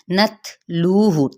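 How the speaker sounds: नत लू हु split slowly syllable by syllable; syllable demo. Shown as a demonstration. Teacher sounds out नत लू हु slowly for students. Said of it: "ता पे हमस होगा".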